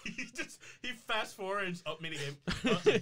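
laughing. Retold or imitated. Laughing while imitating Click.

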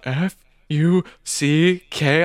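slow, letter-by-letter spelling. Retold or imitated. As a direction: draw out each letter for a clueless friend. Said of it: "they eventually spelled it out".

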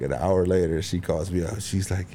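ordinary talk

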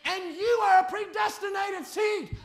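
loud, rising preacher's cry to the flock; fiery preaching. Acted. Pastor pleading with the congregation, loud fiery preaching. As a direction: preach it out, pushing hard on every phrase.

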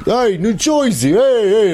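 high, loudening mock New Jersey accent; caricature New Jersey accent. Imitating people teasing him about home, caricatured New Jersey accent. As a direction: tease with a cartoon Joisey accent. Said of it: "anytime people are like".

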